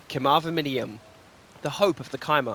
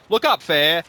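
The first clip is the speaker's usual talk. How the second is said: calling out; 3 semitones higher, 8 dB louder than usual. Acted. King calls to Fair.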